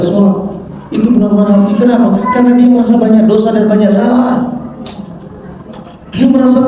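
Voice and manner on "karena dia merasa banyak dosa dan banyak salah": usual speaking voice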